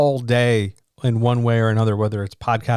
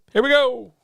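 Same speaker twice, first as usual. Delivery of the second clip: excited; 13 semitones higher than usual. Acted. Launching the stream intro.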